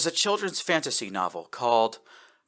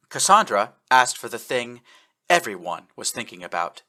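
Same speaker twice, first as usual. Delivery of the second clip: ordinary delivery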